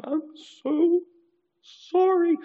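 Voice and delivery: high, loud mock blubbering; crying apology. Offender crying while apologizing after smacking again, high and wailing. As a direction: wail the apology through fake tears. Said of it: "this person's crying".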